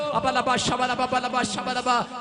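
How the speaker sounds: rhythmic tongues chant; speaking in tongues. Acted.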